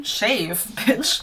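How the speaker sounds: ordinary talk, nothing marked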